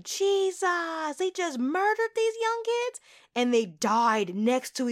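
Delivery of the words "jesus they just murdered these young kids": high, shocked outcry at the murder; shocked disbelief. Retold or imitated. Recalling her reaction to the execution scene, high shocked disbelief. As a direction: gasp it out in horrified disbelief. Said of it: "And I was like".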